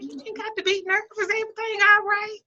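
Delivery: high-pitched, gentle comforting; mock-sweet reassurance. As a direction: lift it right up to soothe someone nervous. Reassuring the nervous nail technician.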